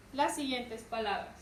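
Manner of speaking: normal talking voice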